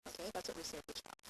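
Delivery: ordinary talk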